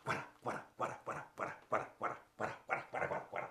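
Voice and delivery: soft unvoiced wada wada; rabbit sitting sound. Shown as a demonstration. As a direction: puff it out quietly. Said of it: "Do this with me".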